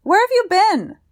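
put-on high, loud little boy's voice, bursting with delight; a small boy demanding an answer with glee; excited child. A narrator's reading. Nephew greeting his uncle John, high excited child's voice.

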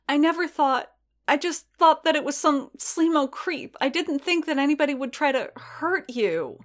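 high, upset character voice; distressed. A narrator's reading. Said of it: "twitching in distress".

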